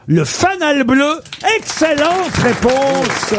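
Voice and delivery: high, stretched-out announcer delivery; triumphant confirmation. Real, not acted. Quiz host confirming the panellist's answer, triumphant confirmation. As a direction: hail the answer slowly with soaring pitch.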